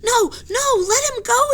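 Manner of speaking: high, loud plea; pleading cry. Acted. Jack pleads with the men, high and loud. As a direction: beg them to stop. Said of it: "said Jack".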